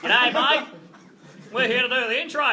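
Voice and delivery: hammed-up Aussie ocker accent; overdone true-blue Australian; broad Australian accent. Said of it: "True Australian, mate".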